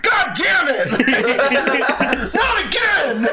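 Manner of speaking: high, loud, laughing mock exasperated shout; a hammed-up furious librarian blowing up; fed-up librarian. Librarian seeing the prank again, mock exasperated shout. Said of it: "see the librarian come up and be like".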